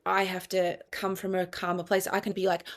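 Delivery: normal talking voice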